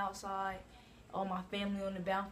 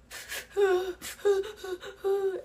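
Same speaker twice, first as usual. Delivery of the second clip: mock sobbing sigh; 12 semitones higher, 5 dB louder than usual. Everyone crying at farewell.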